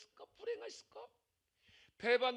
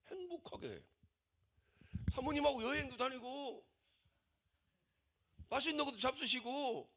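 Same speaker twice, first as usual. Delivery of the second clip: higher voice, hammed-up coaxing concern; imitating a worried congregant; 6 semitones higher than usual. Pastor imitates a member urging him to live happily, higher and coaxing. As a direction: plead with him to enjoy life, half-mocking. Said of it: "나한테 그러시더라고".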